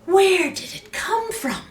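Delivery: high, slow gasping question; amazed villagers. A narrator's reading. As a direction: gawk at the potato. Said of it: "they asked".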